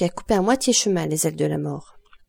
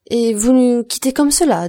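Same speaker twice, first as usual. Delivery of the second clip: higher, louder reproach; upset, raised voice; dismayed protest; 5 semitones higher, 6 dB louder than usual. A narrator's reading. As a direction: object as if hurt by the news. Julie protests the departure.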